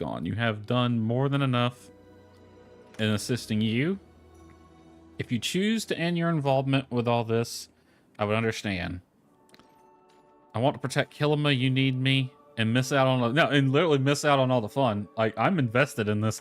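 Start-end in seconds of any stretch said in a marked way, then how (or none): none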